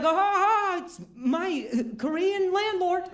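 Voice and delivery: high, playful echo of an excited hello; mock-excited greeting. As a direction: greet back way up high, with a fake laugh. Mirrors the neighbour's excited greeting.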